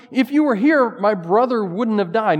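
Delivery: high, loud anguished plea; grieving sister. Retold or imitated. Grieving sister asks Jesus why he didn't come, loud anguished plea. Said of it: "They asked Jesus the same hard question".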